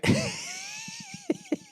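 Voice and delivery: a shrieking burst of laughter; high squealing laugh. Real, not acted. Laughing at his own rant about being uplifting.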